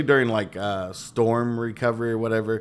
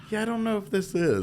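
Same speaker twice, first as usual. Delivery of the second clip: skeptical; 12 semitones higher than usual. Retold or imitated. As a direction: sound unconvinced by the caller. Doubting a fraud call.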